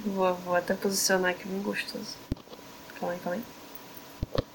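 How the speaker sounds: soft whispered ASMR. Shown as a demonstration. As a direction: whisper close to the mic. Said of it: "S M R aí de, de costura".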